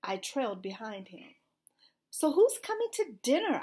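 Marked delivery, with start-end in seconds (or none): 2.1-3.6 insistent question, higher and louder; pressing. A narrator's reading. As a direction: press eagerly for an answer. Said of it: "I pressed".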